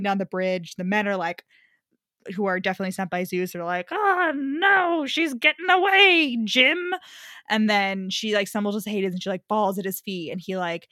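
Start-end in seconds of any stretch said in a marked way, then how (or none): 3.9-6.9 high, hammed-up goon voice; cartoonish goons crying out in a high voice; mock henchmen panic. Henchmen voiced in story retelling.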